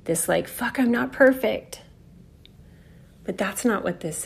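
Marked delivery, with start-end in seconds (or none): 0.5-1.8 panicked. Retold or imitated. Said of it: "the panic of unskillful Virgo".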